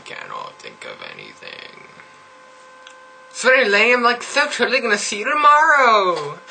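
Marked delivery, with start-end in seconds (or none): 3.3-6.4 put-on high bubbly valley girl voice, every totally gushed; mock valley girl accent. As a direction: play a ditzy valley girl gushing to her friends. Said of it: "I really can't do this accent".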